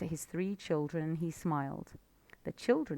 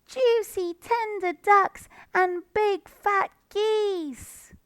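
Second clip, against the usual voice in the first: very high, slow loud cry; hungry cubs licking their lips; excited fox cubs; 9 dB louder, slower than usual. A narrator's reading. Cubs savouring.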